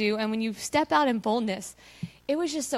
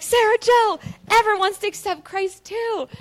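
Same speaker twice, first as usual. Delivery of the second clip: much higher, loud thrilled call; excited shout; 9 semitones higher, 7 dB louder than usual. Retold or imitated. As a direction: shout the good news across the room. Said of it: "I'm like".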